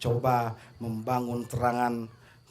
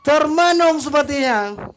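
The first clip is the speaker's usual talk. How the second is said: high, loud excited play-by-play; excited commentary; 13 semitones higher, 9 dB louder than usual. Real, not acted. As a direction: keep the goal frenzy going. After the goal call.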